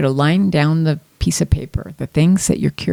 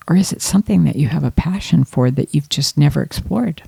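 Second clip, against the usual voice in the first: ordinary delivery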